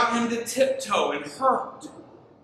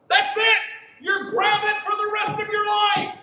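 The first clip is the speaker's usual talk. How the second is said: much higher, louder hammed-up outburst; mock angry parent yell; 13 semitones higher, 3 dB louder than usual. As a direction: explode like a furious parent, for laughs. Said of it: "It's like".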